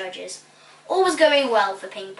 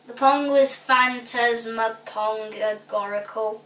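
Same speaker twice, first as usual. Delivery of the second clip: usual speaking voice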